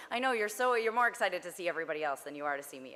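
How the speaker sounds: ordinary talk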